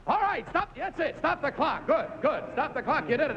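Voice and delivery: high, bursting call to stop the clock; excited calling out. Real, not acted. Host shouting as the contestant solves it, excited. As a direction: cry out the win the moment it lands.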